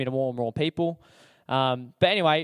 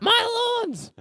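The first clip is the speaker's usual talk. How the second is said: shrieked order; slower than usual. Retold or imitated. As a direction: bark the chore at him like a fed-up housemate. Housemate girls shouting orders.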